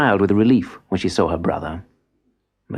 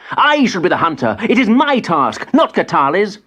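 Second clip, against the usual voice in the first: much higher, louder furious claim; angry insistence; 10 semitones higher, 4 dB louder than usual. Acted. Boy insists he should hunt, higher and furious. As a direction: demand what is his, outraged. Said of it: "snarled Madubi".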